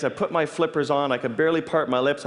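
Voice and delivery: normal talking voice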